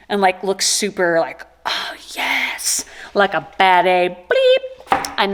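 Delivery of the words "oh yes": whispered aside. Pretend. Mock reaction inside story.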